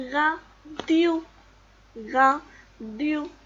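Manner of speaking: "radio" split loudly into ra, dio; mot découpé. Shown as a demonstration. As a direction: sound out "radio" syllable by syllable. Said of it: "Comme dans le mot". (« radio » découpé fort en ra, dio)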